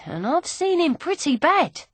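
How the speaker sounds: ordinary delivery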